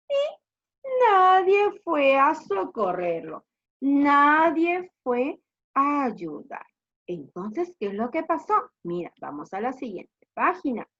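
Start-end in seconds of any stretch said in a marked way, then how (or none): 0.1-6.7 slow, drawn-out emphatic telling; dramatic story narration. Acted. Teacher tells pupils no help came, dramatic. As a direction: stretch each word for young listeners.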